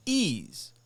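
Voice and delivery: "ease" set apart and emphasized; stress on ease. Real, not acted. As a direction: land hard on the word "ease", drawn out. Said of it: "put the emphasis on the word".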